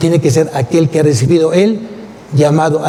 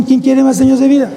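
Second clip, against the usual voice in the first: "¿Quién quiere más años de vida?" repeated higher and louder, pressing the crowd; preacher challenging the congregation, pitch up and louder; 6 semitones higher than usual. Shown as a demonstration. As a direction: push the question back at them, raised and insistent.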